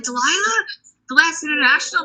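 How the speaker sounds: high, rising voice of surprised recognition; excited fan. Retold or imitated. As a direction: imitate an excited fan's high-pitched question. Retold fan recognizing the singer.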